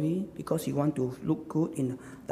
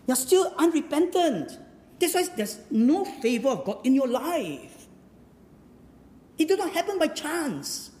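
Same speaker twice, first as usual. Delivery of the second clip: raised pitch, emphatic falling sentence ends; impassioned preaching; 8 semitones higher than usual. Acted. Challenging the congregation over unanswered fasting, impassioned preaching. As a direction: preach it hard and high.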